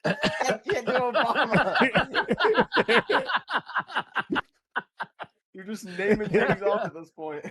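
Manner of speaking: high giggling laughter; laughing. Real, not acted. Laughing at friends naming voices, giggly. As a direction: laugh through every word.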